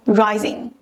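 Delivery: ordinary talk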